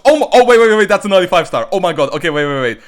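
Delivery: loud, high burst of surprise; real shock, rapid high-pitched outcry; startled excitement. Real, not acted. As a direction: blurt it out, cutting yourself off in disbelief. Surprised by an early five star.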